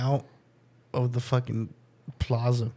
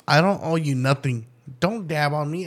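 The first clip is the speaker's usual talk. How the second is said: ordinary talk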